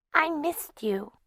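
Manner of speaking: put-on high, slow small child's voice; a sleepy four-year-old; little girl. Four-year-old Margaret speaks.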